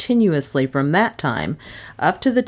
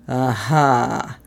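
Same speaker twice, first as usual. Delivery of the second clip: drawn-out, satisfied aha; low-key gloat of a lawman; 2 semitones lower than usual. A narrator's reading. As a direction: pounce on it with relish. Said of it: "Humphrey gloated".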